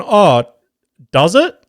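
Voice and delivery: badly faked surprise. Tradie to suspicious mum, badly faked innocent surprise. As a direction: ham up innocent shock to dodge blame. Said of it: "I performed some of the worst acting".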